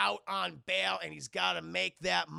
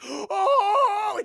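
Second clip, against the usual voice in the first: much higher, much louder shriek of panic; panicked yelp; 14 dB louder than usual. Retold or imitated. Jesse losing the race, high loud panicked scream. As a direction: shriek like you just lost everything. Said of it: "Jesse fucking panics".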